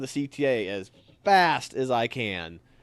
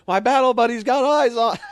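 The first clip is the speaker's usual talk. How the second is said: yelling; 9 semitones higher, 6 dB louder than usual. Retold or imitated. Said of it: "and I yell".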